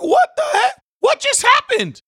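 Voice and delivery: high, loud shocked cry of disbelief; shocked shout. Retold or imitated. Preacher retelling his shock at Disney World, loud high shout. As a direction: yell out in disbelief. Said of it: "I'm like".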